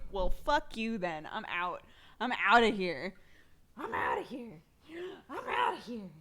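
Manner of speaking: mock-indignant lines put in the baby's mouth; voicing the baby. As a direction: lightly act out a fed-up baby storming off. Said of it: "She's like".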